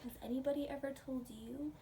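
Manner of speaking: ordinary delivery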